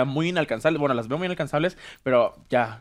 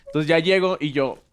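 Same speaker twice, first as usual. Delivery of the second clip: ordinary talk, nothing marked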